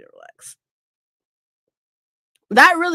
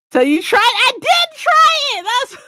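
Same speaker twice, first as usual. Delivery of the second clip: indignant protest; 13 semitones higher, 7 dB louder than usual. Real, not acted. Defending herself to chat.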